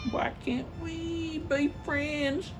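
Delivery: pleading nephew. Acted. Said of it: "nephew pleads".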